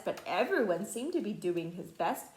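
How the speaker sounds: usual speaking voice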